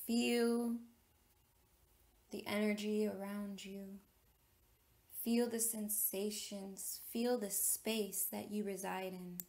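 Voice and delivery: measured meditation leader, long pauses between phrases; deliberate, spacious meditation delivery; guided meditation. Real, not acted. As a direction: guide listeners inward, phrase by phrase, letting key words stretch.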